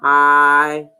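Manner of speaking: "I" held long on a low, level pitch; long I sound. Shown as a demonstration. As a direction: draw out "I" like the word eye, low. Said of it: "Make the long I sound".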